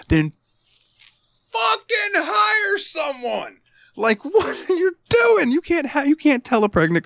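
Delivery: very high, drawn-out squawk of outrage; comic high-pitched rant at a boss; exasperated outburst. Acted. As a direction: screech it in disbelief, dragging the words out.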